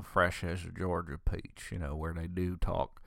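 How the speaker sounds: lightly imitated genteel Georgia accent; soft, low touch of Georgia southern; distinguished Georgia accent. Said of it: "they talk a little more distinguished".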